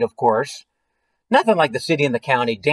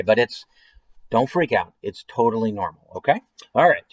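plain reading voice

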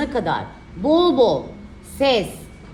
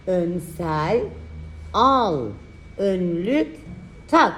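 dictating; 4 semitones lower, slower than usual. Shown as a demonstration. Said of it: "dikte çalışması".

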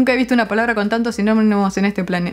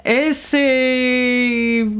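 drawn-out word; much slower than usual. Shown as a demonstration. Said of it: "cuando digo guachín".

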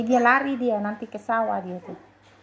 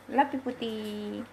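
tender pet talk; 3 semitones lower, 6 dB quieter than usual. Real, not acted. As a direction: coo low and softly. Murmured affection to pet. (bisikan sayang yang lembut)

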